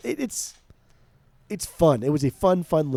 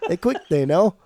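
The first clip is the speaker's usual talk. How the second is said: badly faked English accent, pitched up; louder, higher mock English accent; shitty English accent; 4 semitones higher, 5 dB louder than usual. Co-host repeats the album title in a bad English accent. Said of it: "like, a shitty English accent".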